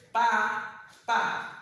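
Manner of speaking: "papa" with first "pa" held, then a gap; one voice burst per syllable. Shown as a demonstration. Teacher demonstrating the voice bursts, "papa" syllable by syllable. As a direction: split "papa" into two drawn-out syllables. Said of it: "coup de voix".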